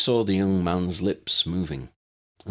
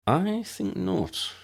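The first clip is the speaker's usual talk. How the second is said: higher, slow disapproving reply; slow frowning no; 11 semitones higher, much slower than usual. A narrator's reading. Ambrose rejects Ron's doubt, slow and frowning. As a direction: draw it out, frowning. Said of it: "Ambrose frowned".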